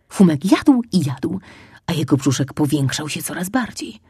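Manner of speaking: plain reading voice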